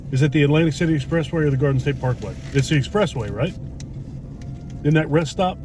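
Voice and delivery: normal talking voice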